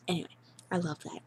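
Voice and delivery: ordinary talk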